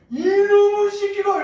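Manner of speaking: shrill furious mother's yell, high and loud; slow, drawn-out high yell; mom yelling. Retold or imitated. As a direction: scream it like an enraged mom catching her kid. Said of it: "이러더니".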